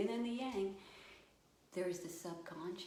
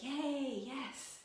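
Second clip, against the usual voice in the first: higher-pitched happy little cheer; child's delight; 6 semitones higher than usual. Acted. Child answering a make-believe game, delighted. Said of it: "they're gonna be like".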